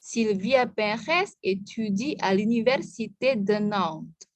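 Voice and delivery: Sylvia Perez étudie à l'université de Nantes, read as a careful French model; careful French reading demo; French model sentence. Shown as a demonstration. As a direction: read it out for the class to copy. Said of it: "Écoutez, dengarkan saya".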